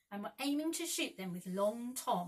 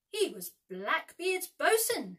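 ominous warning; 8 semitones higher, 9 dB louder than usual. A narrator's reading. Peter warns of Hook.

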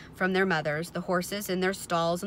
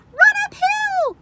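very high, loud slow cry; drawn-out high-pitched shout; sheep crying out; 13 dB louder, slower than usual. A narrator's reading. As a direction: shriek it like an alarmed sheep. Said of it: "cried the sheep".

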